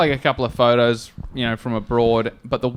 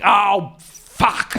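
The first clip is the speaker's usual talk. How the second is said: weary curse; 5 semitones higher than usual. Retold or imitated. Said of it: "Kenny's just like".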